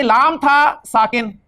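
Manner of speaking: लाम था साकिन said slowly, leaning on था; teacher slowing down on the key point; slow emphasis. Shown as a demonstration. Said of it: "लेकिन problem ये थी".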